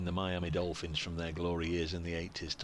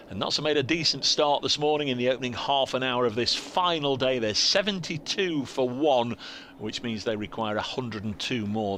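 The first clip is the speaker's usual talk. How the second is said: louder, raised sports-bulletin delivery; radio score update; 3 semitones higher, 4 dB louder than usual. Real, not acted. Commentator updating Radio Nottingham listeners on the final day, projected bulletin read. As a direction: project a crisp summary of play for station listeners.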